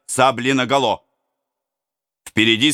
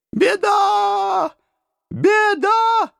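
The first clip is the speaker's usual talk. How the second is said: high wailing shout of alarm; high alarmed cry; women crying out; much slower than usual. A narrator's reading. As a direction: wail it like village women. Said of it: "кричали они".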